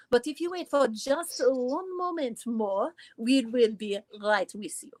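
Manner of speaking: slow, put-on French accent; hammed-up French accent, leisurely and charming. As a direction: lilt through it like a Parisian hostess with a French accent. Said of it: "How about Ann Taylor in Paris?".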